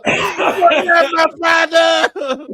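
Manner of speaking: slow, crying dramatic wail; hammed-up tearful cry. Son staring at his father, redone as a melodramatic tearful shout.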